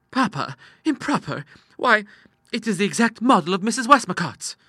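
put-on high, incredulous girlish voice; protesting daughter. Daughter defending dress to papa, high incredulous protest.

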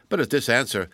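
usual speaking voice

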